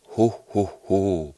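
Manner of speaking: loud, hearty ho ho ho; Santa's laugh found for good. Acted. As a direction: let the Santa laugh ring out. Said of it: "Nu var den der".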